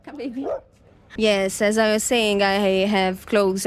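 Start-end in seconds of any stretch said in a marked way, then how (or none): 0.1-0.5 gently coaxing, much softer. Real, not acted. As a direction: coax softly like to a pet. Aside coaxing someone softly.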